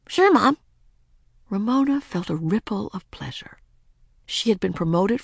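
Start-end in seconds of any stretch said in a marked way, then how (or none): 0.1-0.6 put-on high eager girl voice; bright little girl answering; young girl voice. A narrator's reading.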